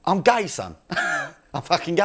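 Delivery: dad confessing. Retold or imitated. Dad coming out to son at Christmas, slow blunt confession. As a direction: lay it out plainly, slowly. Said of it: "he goes".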